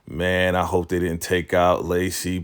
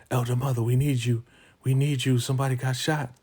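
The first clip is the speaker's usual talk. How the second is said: high-pitched, mock-urgent plea; acted-out alarm, higher than usual; urgent call for help; 7 semitones higher, faster than usual. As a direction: imitate someone calling for emergency help, pitched up.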